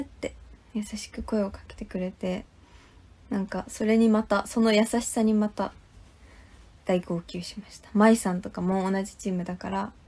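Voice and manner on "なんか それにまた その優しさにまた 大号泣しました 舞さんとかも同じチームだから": normal talking voice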